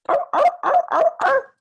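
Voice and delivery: very high, loud seal arr barks. Retold or imitated. Teacher imitating a seal for the children, high barking. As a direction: bark like a playful seal. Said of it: "it could make a sound like".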